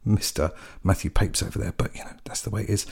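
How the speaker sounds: whispered aside to listeners about a legal secret; conspiratorial whisper. Real, not acted.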